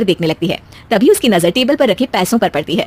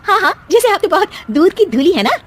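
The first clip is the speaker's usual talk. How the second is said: higher, mocking retort; sarcastic jab; 9 semitones higher than usual. Daughter-in-law mocking her mother-in-law's innocence, sarcastic. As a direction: roll your eyes at her.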